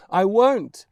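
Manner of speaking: loud, high refusal; a stubborn boy yelling back; defiant shout. Acted. Boy refusing his mother's order to leave, shouted.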